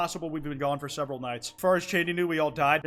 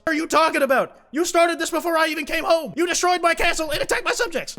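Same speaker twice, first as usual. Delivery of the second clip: high, loud indignant yell at his siblings; angry shout; 12 semitones higher, 7 dB louder than usual. Acted. Prince yelling at his siblings, outraged and loud.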